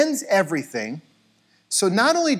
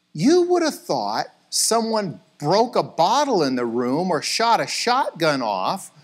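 normal talking voice

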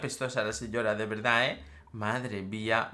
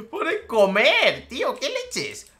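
high, laughing, drawn out; laughing disbelief; 10 semitones higher, 6 dB louder, slower than usual. Real, not acted. Gamer laughing at a typo in the trade menu, slow high disbelief. Reads "comer" on the screen.

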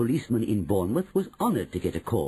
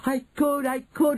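put-on high, thrilled boy's cry; bursting with eagerness; excited cry; 14 semitones higher, 3 dB louder than usual. A narrator's reading. Boy thrilled at sneaking into the castle, excited cry. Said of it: "I cried out".

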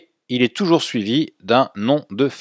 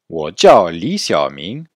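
wo jiao Xiaoming spoken at half speed, a bit louder; slow model phrase; 2 semitones lower, 5 dB louder, slower than usual. Shown as a demonstration. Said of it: "Répétez après moi". (wo jiao Xiaoming, lentement et articulé)

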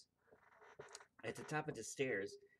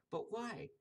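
high puzzled cry; surprised cry; 9 semitones higher than usual. Acted. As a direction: ask it high in disbelief. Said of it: "cried Tommy and Stephanie".

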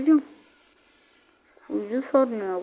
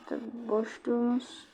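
normal talking voice

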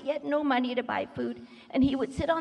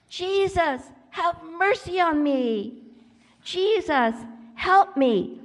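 higher, drawn-out plea; acted yell; 5 semitones higher, 4 dB louder, much slower than usual. Blind Bart calls to Jesus for mercy, pleading yell. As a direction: call out for help, stretching the words. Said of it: "and in the loudest voice possible, he yelled".

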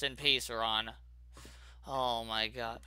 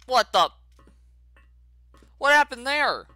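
high, loud startled question; shocked gamer after a glitch; surprised; 7 semitones higher, 7 dB louder than usual. Real, not acted. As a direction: blurt it in shock. Fell out of the map.

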